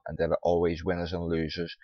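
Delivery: ordinary talk, nothing marked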